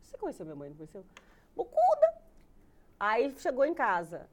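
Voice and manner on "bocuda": very high, loud exclamation; mock loudmouth. Daughter jokes about her outspoken mother, high and loud. Mimes mother's big mouth.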